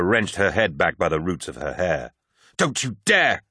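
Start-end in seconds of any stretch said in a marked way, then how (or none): 2.6-3.4 high, furious warning scream; high-pitched outraged cry. A narrator's reading. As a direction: scream it in desperate protest. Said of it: "she screamed".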